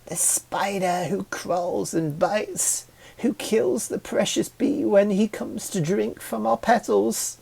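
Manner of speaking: swooning, overdramatic. Acted. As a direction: ham up the tragedy of the bee. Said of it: "the red one swooned".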